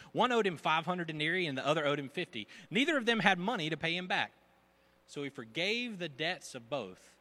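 high, loud storyteller read; parable telling. Retold or imitated. Jesus telling parable loudly.

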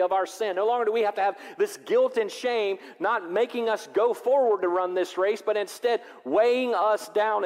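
loud, high, ringing sermon voice; full-force preaching. Real, not acted. Pastor preaching to his congregation, loud and high. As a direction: preach it out to the whole church.

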